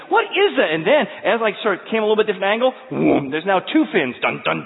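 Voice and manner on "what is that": high, loud alarmed cry; a sudden jolt at the sight; startled. Retold or imitated. Spotting a second fin in the lake, high startled cry.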